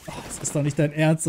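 normal talking voice

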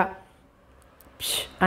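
soundless breathy kiss blown; a hushed kiss sent across the room; silent blown kiss. A narrator's reading. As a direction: blow the kiss with no voice at all. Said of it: "శబ్దం బయటకు రాకుండా".

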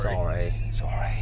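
breathy whispered apology, barely voiced; whispered sorry. Real, not acted. Driver edging past pub benches, whispering sorry to onlookers. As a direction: murmur it under your breath to the people you're squeezing past.

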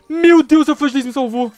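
loud, high-pitched exclamation; astonished relief. Real, not acted. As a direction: burst out at a last-second rescue. Salvo no último instante. (exclamação alta de alívio e espanto)

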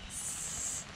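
long hissing "S" through teeth; "S" as a steady hiss, teeth together; hissed s sound. Shown as a demonstration. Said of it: "Can you do it, [name]?".

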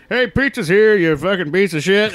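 high, loud sudden switch to cursing; friendly greeting turning into an abusive yell; mock angry outburst. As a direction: snap from cheery to vicious. Actor's outburst acted out.

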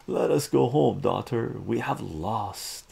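lightly voiced alien father, loud at the start. A narrator's reading. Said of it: "The alien father comes down".

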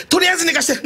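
imitating a rising desperate plea. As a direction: push the plea up further. Repeated plea, higher.

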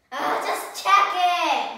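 loud, high, slow insistence; loud demand. Real, not acted. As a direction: insist loudly on it. Sibling bickering over books.

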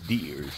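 "deers" with an S on the end; grandmother's pronunciation. Retold or imitated. Quoting his grandmother on hunting, folksy "deers" with an added S. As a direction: quote "deers", low and soft, adding the plural S. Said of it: "as my grandmother would say".

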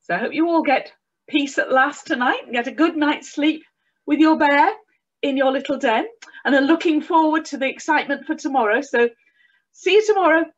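high, loud, bright sign-off; cheery goodnight. Real, not acted.

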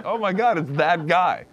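ordinary talk, nothing marked

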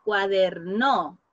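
cuaderno said slowly, leaning on the syllable der; slow stressed word. Shown as a demonstration. Teacher to class, modelling where the stress falls. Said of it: "¿Cuál sería nuestra sílaba tónica".

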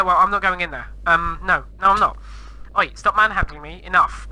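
ordinary delivery

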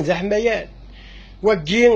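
usual speaking voice

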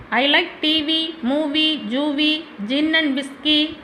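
very slow, lilting rhyme; slow sing-song list of likes. A narrator's reading. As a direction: drawl the rhyming list lazily. Rhyming answer drawn out slowly.

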